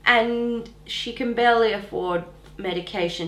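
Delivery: ordinary talk, nothing marked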